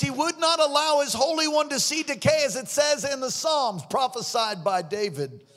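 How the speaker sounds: loud, high impassioned preaching; preaching at full force. Real, not acted. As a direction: preach it out to the crowd. Preaching the resurrection passage.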